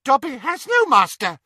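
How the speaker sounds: high, loud squealing elf; squealed. A narrator's reading. Dobby defying Bellatrix, squealing. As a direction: squeal it defiantly. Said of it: "squealed the elf".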